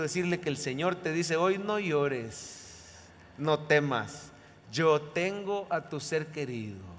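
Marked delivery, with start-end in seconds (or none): 1.6-6.9 low, soft and slow; hushed, unhurried reassurance; tender consolation. Acted. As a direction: console a mourner as the Lord would. Voices the Lord consoling a mourner.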